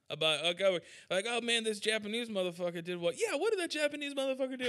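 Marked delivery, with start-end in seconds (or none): 1.3-4.6 fast, high mock rant; Bill Burr-style rant. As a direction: rattle it off like an angry comic. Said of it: "that Bill Burr joke".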